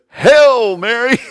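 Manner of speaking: high, loud, slow "Hail" sounding like hell; mock old director's proclamation. Preacher imitates old choir director proclaiming, loud mock "Hell Mary". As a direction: ham up the old guy's booming "Hail Mary!". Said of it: "that old guy ... pronounced it "hell".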